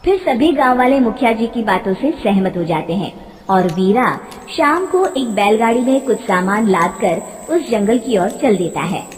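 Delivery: ordinary delivery, nothing marked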